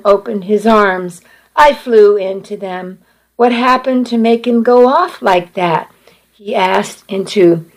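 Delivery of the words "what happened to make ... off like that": plain reading voice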